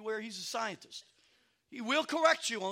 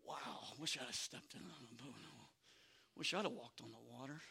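lowered, soft rueful murmur; envious disciples; 9 semitones lower, 12 dB quieter than usual. Retold or imitated. Disciples wishing they had stepped out too, soft and rueful. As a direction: sigh it out like a jealous bystander. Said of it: "Everybody's like".

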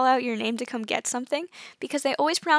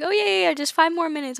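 high eager relative voice; chatty relative; 5 semitones higher, 3 dB louder than usual. Retold or imitated. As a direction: brush off the goodbye breezily. Relative keeps talking.

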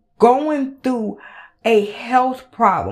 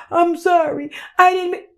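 higher, whiny mocking apology; 7 semitones higher than usual. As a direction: mimic her fake sorry voice. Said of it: "you put out".

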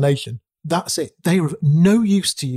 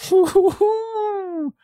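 high burst of laughter. Real, not acted. Big laugh at the flaw.

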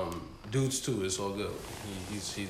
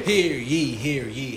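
proclaiming hear ye, fading; town crier; 3 semitones higher, 7 dB louder, slower than usual. Acted. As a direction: announce it like a crier. Town crier opening call.

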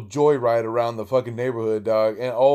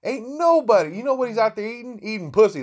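incredulous jeer; 10 semitones higher than usual. Real, not acted. Mocking the breakfast excuse.

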